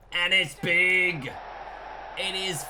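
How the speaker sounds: slow, drawn-out, loud commentator roar; big-hit call. Acted. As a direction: stretch the call as the ball flies.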